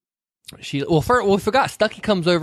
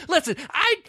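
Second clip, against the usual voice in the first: mock Vivian. Vivian quoted, forgets line.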